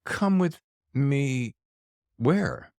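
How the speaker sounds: slow, drawn-out and high; intrigued questioning. Acted. Host recalling their reaction to the book title, intrigued. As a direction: draw the words out, then ask.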